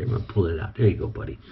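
soft, low words to the snake; gentle murmur to the snake. Real, not acted. Keeper softly coaxing his snake off the rat. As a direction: ease it out quietly, coaxing.

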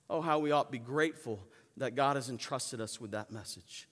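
usual speaking voice

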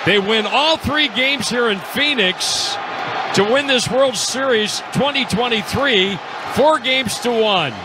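high-pitched, drawn-out championship call; play-by-play call. Real, not acted. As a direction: call the final out as a ringing announcement.

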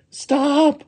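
very high-pitched cry. Acted. Little woman to her rolling dumpling, high cry. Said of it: "cried the little woman".

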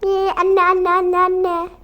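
put-on child nagging for mother; whining child. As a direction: pester her like a needy little girl.

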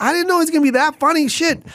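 fast, high retold excuse; defensive protest. Show's owner answering the angry host, high defensive protest.

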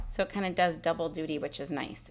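usual speaking voice

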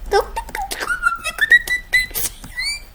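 very high, soft cartoon squeak; cute squeaky voice. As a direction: squeak it out like a tiny cute creature. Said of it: "Konuşmasına bak". (çok ince, sevimli cırtlak ses)

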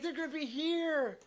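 high, giddy retold excitement about family coming; retelling his thrill that family is visiting; excited. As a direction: squeal over the dog coming too. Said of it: "I'm like".

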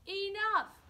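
loud fed-up villagers' cry; shouted enough. A narrator's reading. Villagers fed up with dragon, loud shout. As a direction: yell it, fed up. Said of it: "they said".

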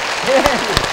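a big amused laugh; hearty laugh. Real, not acted. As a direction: burst out laughing. Host laughs at answer.

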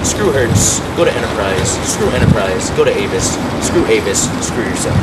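low ranting brush-off. Acted. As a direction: growl the list away. Ranting list.

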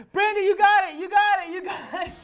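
excited cheering, rising pitch. Real, not acted. Cheering friend's bubble.

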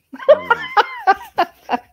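high, loud burst of laughter; giddy laugh. Real, not acted. Host swoons over the handsome actor, giddy laugh.

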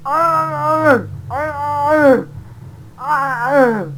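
high loud repeated ay of alarm; loud dying cry. Real, not acted. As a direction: cry out as you crash. Dies in game fall.

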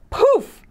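high sudden magical poof. Acted. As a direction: pop it like a magic trick. Magic transformation sound effect.

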